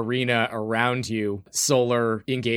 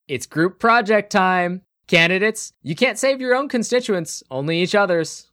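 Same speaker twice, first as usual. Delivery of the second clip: game show host; 8 semitones higher, 4 dB louder than usual. Acted. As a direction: announce it like a reality show host. Savannah announces.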